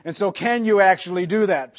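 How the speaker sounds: usual speaking voice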